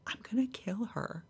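hushed, voiceless inner thought; whispered aside. Retold or imitated. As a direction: whisper it under your breath. Private reaction on meeting trainer.

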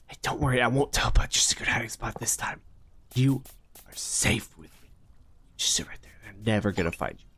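hushed secret whisper. Real, not acted. Window cleaner whispering reassurance to a hider. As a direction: whisper so nobody else hears.

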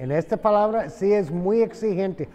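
usual speaking voice

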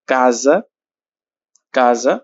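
কাজা said with a clear hard k; hard k demo. Shown as a demonstration. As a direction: show the k in কাজা plainly. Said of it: "এখানে উচ্চারণ হয়েছে কে এর মতো".

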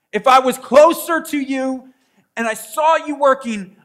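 Paul's frustrated plea. Retold or imitated. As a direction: voice Paul's exasperation at his flock. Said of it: "He states it".

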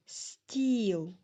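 "Стіл" as one long drawn-out syllable; one stressed syllable. Shown as a demonstration. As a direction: stretch the vowel of "Стіл". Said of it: "тільки один склад".

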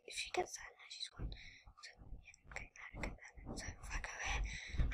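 hushed whisper, no voice at all. Real, not acted. Tense moment in the game, whispering to herself. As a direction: whisper so as not to break the tension. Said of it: "I don't know why I'm whispering".

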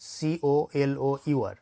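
very slow letter-by-letter সিওএলওইউআর; teacher slowly spelling each letter for the class. Shown as a demonstration. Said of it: "এখানে একটা জিনিস দেখো".

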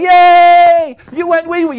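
high, loud drawn-out cheer; praising a puppy. Acted. Owner praising puppy for using the wee-wee pad, excited cheering. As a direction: cheer like the puppy just performed a miracle. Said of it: "you reinforce her be-, positive behavior".

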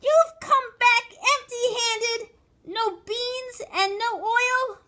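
very high, loud, slow disbelief; shrill, drawn-out astonishment; astonished outcry. A narrator's reading. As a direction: cry out aghast at the child. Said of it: "called out in astonishment".

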